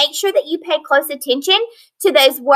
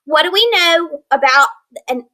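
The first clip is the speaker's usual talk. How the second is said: ordinary talk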